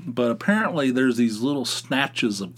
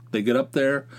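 usual speaking voice

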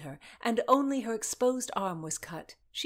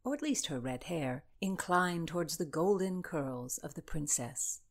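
plain reading voice

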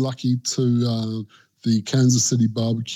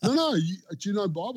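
higher, softer, insistent question; imitating American locals; 8 semitones higher, 6 dB quieter than usual. Kansas City locals pressing the Australian, lightly mimicked.

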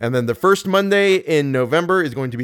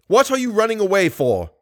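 high, raised angry demand; furious; 8 semitones higher than usual. A narrator's reading. Furious voice follows the fleeing girl, furious. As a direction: call after her in a fury. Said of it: "asked the furious voice".

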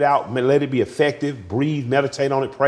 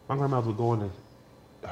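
ordinary talk, nothing marked

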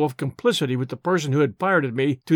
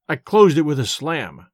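plain reading voice

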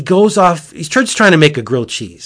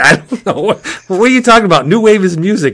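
mock clueless Noah; 6 semitones higher, 4 dB louder, faster than usual. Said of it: "Noah's like".

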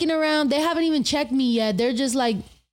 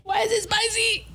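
higher, shocked squeak at "spicy"; surprised; 10 semitones higher than usual. Real, not acted. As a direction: react as if the shot burns. Shocked by a drink with no chaser.

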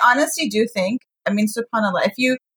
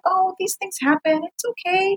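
high, sweet retold reassurance; sweet and forgiving; 7 semitones higher, 3 dB quieter than usual. Retelling her answer to a caller's apology, high and sweet. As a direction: imitate a sweetly understanding woman. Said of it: "she was so sweet".

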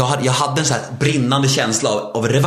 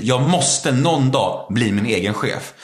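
normal talking voice

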